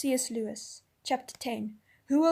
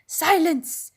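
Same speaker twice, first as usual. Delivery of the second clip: loud, high commanding shout; thundering; 5 semitones higher, 9 dB louder than usual. A narrator's reading. Rishda Tarkaan silences the crowd with a thundering shout. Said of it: "thundered Rashta Khan".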